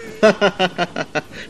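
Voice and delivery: high, light burst of laughter; amused chuckling laugh; chuckle. Acted. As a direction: let out a tittering laugh. Watson chuckles at the landlord's history.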